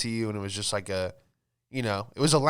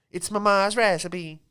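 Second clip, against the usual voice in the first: mock Italian cook; 8 semitones higher than usual.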